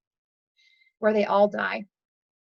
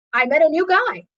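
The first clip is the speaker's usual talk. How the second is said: bright upswing, higher and louder; perky, raised-pitch delight; 5 semitones higher, 6 dB louder than usual. Acted. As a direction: announce good news with mock excitement. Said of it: "But life does this".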